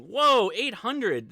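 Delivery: the streamer sees eight hundred viewers, loud and amazed; amazed whoa. Real, not acted. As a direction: let the number knock you back, stretching it out. Surprised by the number of viewers.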